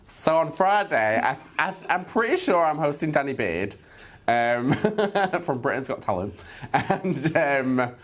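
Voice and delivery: excited. Real, not acted. Excited about hosting Danny Beard.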